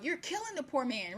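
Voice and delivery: retold scream. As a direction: cry out as the horrified pastor, half-voiced. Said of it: "the pastor screams out".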